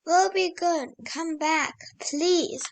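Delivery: usual speaking voice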